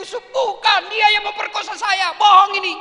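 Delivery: high, loud desperate denial; loud protest. Acted. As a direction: shout the denial. Yusuf's protest. (bantahan keras dan tinggi)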